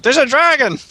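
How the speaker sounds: high, loud cry of alarm; shouted warning. Pretend. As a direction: shout a pretend warning to the guards. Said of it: "food cart and shout".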